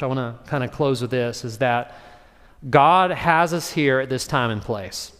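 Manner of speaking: normal talking voice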